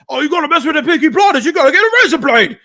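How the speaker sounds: loud, high, fast mock Cockney rant; tipsy table-shouting Cockney impression; yelled Cockney. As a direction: yell a hammed-up Peaky Blinders Cockney threat. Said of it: "you pretty much can only yell it".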